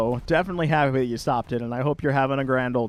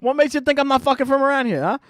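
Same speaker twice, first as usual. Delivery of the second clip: higher, faster brash New York comeback; a hammed-up New York tough guy, a bit louder and higher; brash New Yorker; 9 semitones higher, 4 dB louder, faster than usual.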